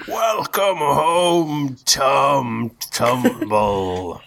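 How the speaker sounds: very slow, high booming loudspeaker voice; booming arrogant alien overlord. Acted. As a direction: boom it out over a loudspeaker. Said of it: "a booming voice over the loudspeaker".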